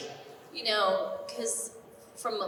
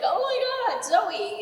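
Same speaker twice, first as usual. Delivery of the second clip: excited squeal; 12 semitones higher, 5 dB louder, slower than usual. Retold or imitated. Starstruck reaction retold.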